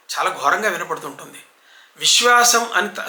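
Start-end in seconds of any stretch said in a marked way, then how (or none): 2.0-2.6 విశ్వాసం slowly with distinct sh; clearly articulated శ in విశ్వాసం; clear sha. Shown as a demonstration. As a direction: say vish-waasam for విశ్వాసం. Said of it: "స్పష్టంగా చెప్పండి".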